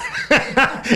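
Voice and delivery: high, loud laugh breaking out; sudden laughing outburst; burst of laughter. Real, not acted. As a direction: let a real laugh burst out. Laughs at brother's likely revenge.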